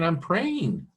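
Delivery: high-pitched plea, not shouted; crying out, complaining. Acted. Prophet complaining to God that prayer goes unheard, crying out. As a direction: plead upward with rising frustration. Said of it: "He's crying out".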